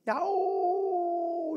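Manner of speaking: high, drawn-out echo call; long far-off mountain echo; distant echo. Retold or imitated. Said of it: "메아리가 돼가지고 저 멀리서".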